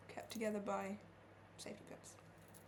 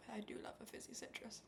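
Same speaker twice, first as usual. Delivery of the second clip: whispering; 9 dB quieter than usual. Real, not acted. Whispered aside while baking.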